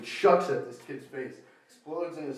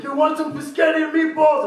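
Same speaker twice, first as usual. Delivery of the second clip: much higher, louder mock stranger voice; high pushy stranger; 9 dB louder than usual. Stranger pushing biscotti on him, high loud voice. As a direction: ham up an eager weirdo.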